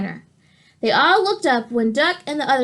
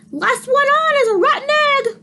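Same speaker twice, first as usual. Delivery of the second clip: much higher, put-on hen voice; Chicken's voice; 13 semitones higher, 5 dB louder than usual. Chicken teasing the others, very high hen voice. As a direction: tease like a cheeky hen.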